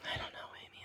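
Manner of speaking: hushed unvoiced whisper. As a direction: whisper it under your breath. Joking reaction after name mix-up.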